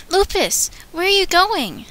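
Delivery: calling Lupus. Acted.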